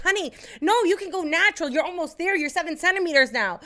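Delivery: high, coaxing imitation of a nurse; reassuring nurse. Nurse telling labouring mother to go natural, coaxing.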